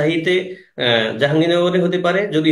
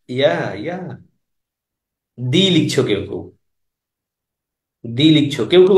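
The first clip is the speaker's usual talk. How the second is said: ordinary delivery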